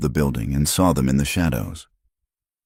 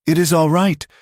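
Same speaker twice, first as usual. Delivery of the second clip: usual speaking voice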